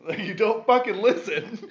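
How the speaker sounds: very high, loud scolding yell with a laugh; retold laughing scream at wife. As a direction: scream the told-you-so. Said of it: "I screamed at you".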